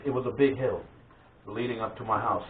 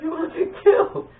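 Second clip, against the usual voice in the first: high-pitched mock yell; loud, high, hammed-up warning; imitated warning yell; 5 dB louder than usual. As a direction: call out like an alarmed cousin. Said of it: "He's like".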